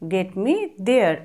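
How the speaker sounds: patient teacher's model reading; slow model phrase. Shown as a demonstration. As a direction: say it out slowly, twice, for learners. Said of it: "তো তাকে এভাবে বলো".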